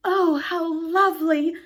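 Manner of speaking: slow, high, longing sigh of delight; longing cry. Acted. Wendy dreaming of the treetop house, longing. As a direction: draw it out with longing. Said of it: "cried Wendy so longingly".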